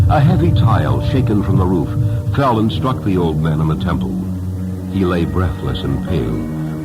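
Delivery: plain reading voice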